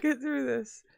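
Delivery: words squeezed out through helpless giggles; fighting back laughter while trying to read; real giggle fit. Real, not acted. Reading a sad passage to her co-host, laughing helplessly. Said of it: "It's been so long since I got the giggles".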